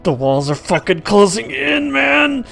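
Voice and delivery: panicked man behind the door; through gritted teeth. Acted. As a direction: grind it out through clenched teeth. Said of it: "behind gritted teeth".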